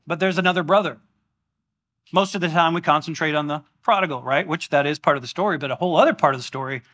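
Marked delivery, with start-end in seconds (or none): none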